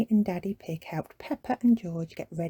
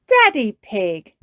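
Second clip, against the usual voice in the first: smiling; 3 semitones higher, 7 dB louder, slower than usual. A narrator's reading.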